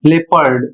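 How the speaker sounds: लेपर्ड said as "lepard", the o silent; correct English pronunciation. Shown as a demonstration. Teacher showing students the silent o, saying लेपर्ड as le-pard. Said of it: "इसका उच्चारण होता है".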